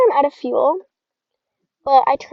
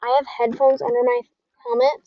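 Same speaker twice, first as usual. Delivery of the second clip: ordinary talk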